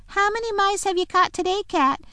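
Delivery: high jeering taunt. A narrator's reading. Creature taunting the bound lion. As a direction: taunt with false sweetness. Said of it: "jeering at him".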